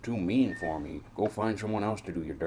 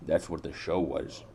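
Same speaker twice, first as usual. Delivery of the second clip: normal talking voice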